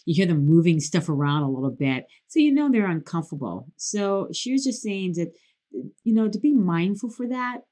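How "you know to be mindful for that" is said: ordinary talk, nothing marked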